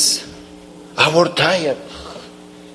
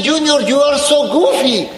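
laughing mock quote; 8 semitones higher than usual. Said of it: "Sometimes the people say".

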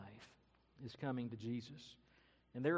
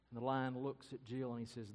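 ordinary delivery

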